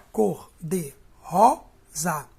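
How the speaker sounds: "Cor de rosa" very slow and loud, each syllable separate. Shown as a demonstration. Teacher counting for students, "Cor de rosa" in loud separate syllables. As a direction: lean hard on "ro" in a split-up "Cor de rosa". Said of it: "a sílaba mais forte é o ró".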